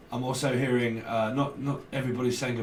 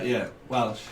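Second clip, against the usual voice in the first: ordinary delivery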